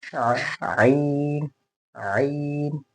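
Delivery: आइन squeezed from mid-throat, long vowel. Shown as a demonstration. Teacher modelling the letter, आइन constricted in the throat. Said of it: "हलक से, यहाँ".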